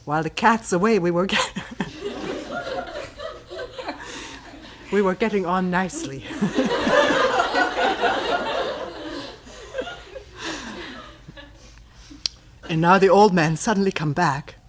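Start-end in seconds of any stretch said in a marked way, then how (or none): 1.6-4.9 high, breathy burst of laughter; real laugh mid-story. Real, not acted. Laughing at himself. 6.3-11.4 long, high rolling laughter; real laugh at the pupils' mischief. Real, not acted. Laughing at himself.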